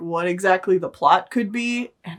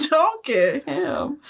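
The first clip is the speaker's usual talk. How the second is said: laughing echo; 6 semitones higher, much slower than usual. Real, not acted. Laughing echo of co-host's line.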